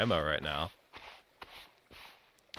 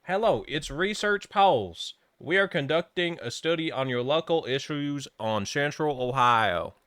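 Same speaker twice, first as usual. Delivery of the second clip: research pollster; 5 semitones higher than usual. Acted. Reading a pollster's message to viewers, mock survey voice. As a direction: read it out as a sing-song survey caller. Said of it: "You'll never believe who just messaged me".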